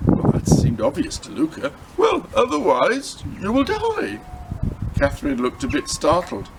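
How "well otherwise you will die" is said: put-on boy Luca's voice. Luca warns Catherine.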